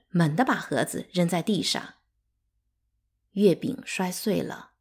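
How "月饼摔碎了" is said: plain reading voice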